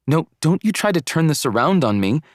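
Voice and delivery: high-pitched indignant rebuke; mad. A narrator's reading. Girlfriend refuses to let boyfriend shift blame, mad. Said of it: "how mad she was".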